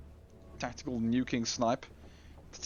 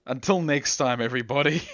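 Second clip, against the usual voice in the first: loud sign-off; 4 semitones higher, 8 dB louder than usual. Real, not acted. Signing off the stream.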